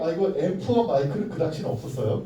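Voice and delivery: ordinary talk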